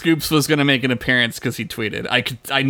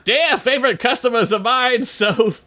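higher, hammed-up sleazy salesman; Scoops McGee voice; 7 semitones higher than usual. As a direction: pitch up and oversell it to the customers. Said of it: "not Scoops McGee's voice".

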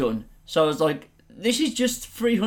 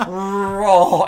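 ordinary talk

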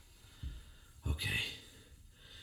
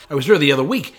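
loud insistence; 9 dB louder, much faster than usual. Acted. Confused man insists to the bartender, raising his voice. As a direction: press the point hard.

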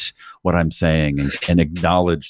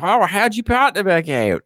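much higher Australian lady voice; Australian accent; 12 semitones higher than usual. Retold or imitated. Surf rental lady asks about the partner in an Australian accent. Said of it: "Australian accent".

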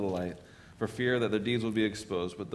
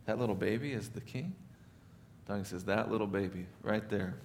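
gentle donkey; 7 dB quieter than usual. Acted. Donkey reveals the king.

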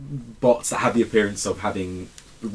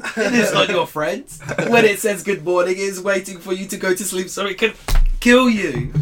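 mock paranoid warning; 7 semitones higher than usual. Mocks his friend's robot paranoia.

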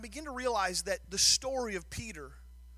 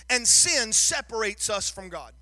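louder and higher, hammering each point to the crowd; preaching at full force; 5 semitones higher, 8 dB louder than usual. Acted. Pastor pressing the congregation on sin, preaching at full force. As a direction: drive the message home like a revival preacher.

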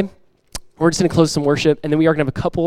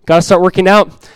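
higher and louder, mock alarm; mock panic; 3 semitones higher, 7 dB louder than usual. Churchgoer dreading summer, mock panicked. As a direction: fret playfully about getting in shape. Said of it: "You're like".

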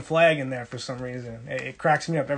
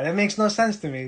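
ordinary talk

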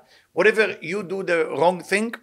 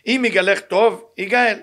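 emphatic quote; 5 semitones higher, 5 dB louder, faster than usual. Acted. Quoting Boaz's vow aloud.

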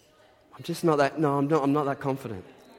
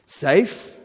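higher, louder incredulous question; indignant beaver querying a silly question; Mister Beaver; 4 semitones higher, 7 dB louder than usual. Acted. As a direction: throw the word back in disbelief. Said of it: "said Mister Beaver".

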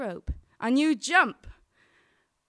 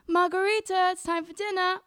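raised, higher mum's call; shouting upstairs; 7 semitones higher, 5 dB louder than usual. Retold or imitated. Mother shouts up to the student at dinner time, imitated high call. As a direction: imitate calling up to another floor. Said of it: "my mother used to shout up the stairs".

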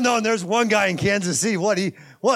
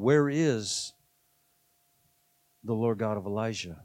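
"Where is the Lord God of Elijah?" dropped much softer and lower, near a murmur; hushed; 10 semitones lower, 6 dB quieter than usual. Shown as a demonstration. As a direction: let the question sink to a hush. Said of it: "that's what I'm saying".

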